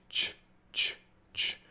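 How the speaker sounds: "ch" released as a voiceless burst; voiceless ch demo. Shown as a demonstration. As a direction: snap out ch with no voice. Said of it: "and C H".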